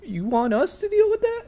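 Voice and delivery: high-pitched, hesitant underling voice; nervous deckhands. Acted. Deckhands asking their fleeing captain, high nervous voice. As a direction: squeak it upward like an unsure underling. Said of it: "these two are just there, and they're like".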